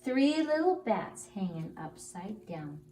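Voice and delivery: plain reading voice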